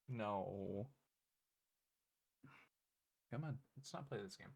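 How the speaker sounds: mildly exasperated, gently shooing the pet; soft pet scolding. Real, not acted. Owner quietly shooing the pet off his monitor.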